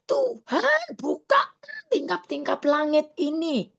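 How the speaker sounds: high, tearful shout to God, voice cracking; crying out in prayer. Retold or imitated. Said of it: "Saya tetap teriak-teriak". (berseru keras sambil menangis memohon berkat Tuhan)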